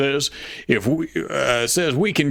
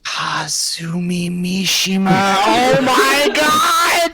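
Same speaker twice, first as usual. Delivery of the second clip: excited; 4 semitones higher, 4 dB louder, much slower than usual. Acted. Dramatic anime name reveal.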